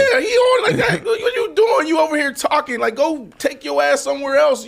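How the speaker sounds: loud and high, laughing through it; fired-up shout at the troll; real excited yelling with laughs; hyped shouting. Real, not acted. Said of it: "you ain't never heard me talk like this".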